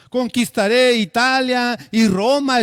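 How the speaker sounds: loud, high, slow proclamation; exclaimed boast. Acted. Alexander boasting his plans to Diógenes, loud and slow. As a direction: proclaim the conquest proudly and slowly. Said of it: "exclamó Alejandro".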